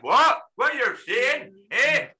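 high-pitched, incredulous Irish impression; Irish accent. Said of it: "that's my Irish accent".

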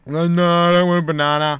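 put-on high, loud whining child; whiny kid voice. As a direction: whine like a refusing kid. Kids refusing, voiced by dad.

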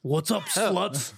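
very slow, drawn-out put-on voice; mock greeting. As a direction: lay it on thick and slow. Imagining his call to company.

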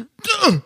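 very high-pitched stuck "euh"; imitated frozen noise. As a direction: mimic freezing up with a high noise. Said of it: "comme ça". (bruit aigu imitant un blocage)

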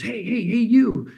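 high, loud retold call-out; mock scream. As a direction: imitate a skier yelling to get someone's attention. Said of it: "start hearing someone screaming at me".

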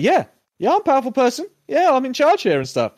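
high, pompous boasting; hammed-up big shot; mock self-important. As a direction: puff it up. Mocking power talk.

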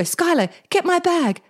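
mildly urgent call to her daughter. Retold or imitated. Hurt mum calls daughter for her bag, a touch urgent. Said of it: "I go".

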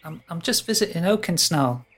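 high, casual faun voice; faun boy. Acted. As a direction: say it lightly like a small faun. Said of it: "He turns to look at the tree and says".

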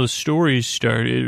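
ordinary delivery

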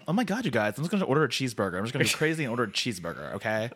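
fast, gushing mock-American chatter; hammed-up American accent, racing along; exaggerated American accent. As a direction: rattle it off like an excitable American. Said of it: "you could have gone like".

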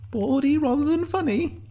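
high-pitched verdict through a fake smile; Sinead's inner voice. A narrator's reading. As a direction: think it high, smiling falsely. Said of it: "thought Sinead, behind a fake smile".

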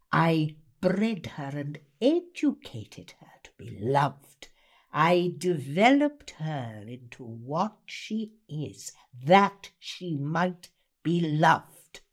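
slow, intense reading with long pauses; Miss Havisham. Acted. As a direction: read it as a bitter, obsessed jilted bride. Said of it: "Miss Havisham is Dickens".

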